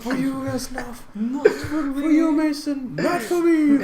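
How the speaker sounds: very high squeaky parody voice; a high, squeaky parody of a war game farewell; squeaky Reznov quote. Retold or imitated. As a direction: squeal it like a joke meme. Quoting the Vine.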